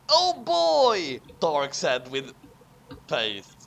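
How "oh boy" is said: put-on higher, eager oh boy; excited oh boy. Dark's line read aloud, eager and higher. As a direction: perk up with delight.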